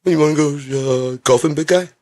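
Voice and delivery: high-pitched playful asking; put-on buddy invitation. Acts out calling him big guy.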